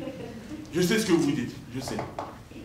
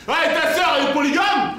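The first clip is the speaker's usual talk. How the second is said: loud high taunting question; mock yell; 12 semitones higher, 8 dB louder than usual. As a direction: shout the jab at him. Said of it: "envie de gueuler intérieurement".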